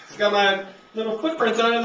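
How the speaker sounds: ordinary talk, nothing marked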